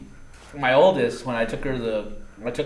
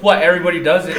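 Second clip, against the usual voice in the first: louder, higher, laughing retort; amused imitation of defiance; mock brazen retort; 6 semitones higher, 9 dB louder, faster than usual. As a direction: imitate a shameless kid, laughing. Retells daughter's brazen retort.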